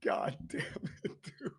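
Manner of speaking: whispered through helpless laughter; can barely get the words out for laughing; breathless laugh. Real, not acted. Host cracking up over the Pistons, wheezing it out breathlessly. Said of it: "I can't stop laughing".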